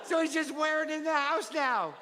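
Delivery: put-on high-pitched little kid telling about a favorite book; child's book report. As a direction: play a giggly child presenting his book.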